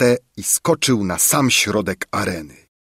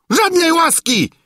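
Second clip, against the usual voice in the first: very high, raised refusal; furious yell; 4 dB louder than usual. A narrator's reading. King Padella refusing the crowd's plea, high yell. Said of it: "wrzasnął Padella".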